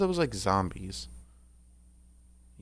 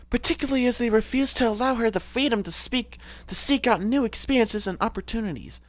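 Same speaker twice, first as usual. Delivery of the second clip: raised-pitch character voice; Anita; 4 semitones higher than usual. Acted. Streamer reading a character's bio aloud, higher put-on voice. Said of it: "what was Anita's voice?".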